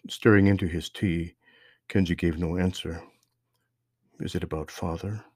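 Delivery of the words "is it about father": soft, hushed motherly question; almost a whisper. A narrator's reading. Mother asking her son about his father, almost whispering. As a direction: ask it gently under your breath. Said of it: "Mother asked almost in a whisper".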